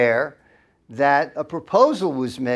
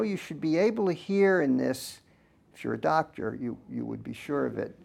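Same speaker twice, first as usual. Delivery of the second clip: ordinary talk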